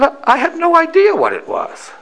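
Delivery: imitating grandmother's comic cluelessness; grandmother's emphatic admission. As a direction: admit it in a high-pitched protest.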